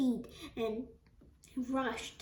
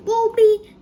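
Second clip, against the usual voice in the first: high, loud wail of dread; distressed cry; 9 semitones higher, 11 dB louder than usual. A narrator's reading. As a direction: wail it out, breaking up the words. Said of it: "cried Grandma Georgina".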